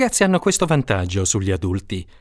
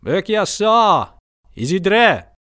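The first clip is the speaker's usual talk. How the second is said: calling to the horse; 8 semitones higher, much slower than usual. Acted. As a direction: urge the horse on with a shout. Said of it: "gridò al cavallo".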